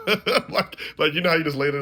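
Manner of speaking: can't hold back the laugh. Real, not acted. Telling viewers the embarrassing part, laughing.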